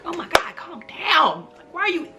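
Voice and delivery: high-pitched retold exasperation; exasperated protest. Patient protesting a rough doctor, exasperated. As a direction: pitch it up in disbelief.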